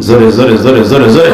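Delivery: low, rapid repeated prompt; low-pitched insistent urging; urging the crowd. Real, not acted. Preacher urges crowd, first round lower.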